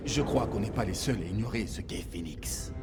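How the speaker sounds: very soft, low undertone; hushed. Acted. Officer confiding to his hidden squad, hushed. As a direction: barely voice it, so only the squad hears. Squad spying on guarded documents.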